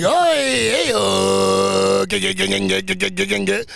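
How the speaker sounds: high stutter on g; stuttering mock barman, starting high; high hammed-up stuttering local; stammering barman. Barman stammering in retold scene.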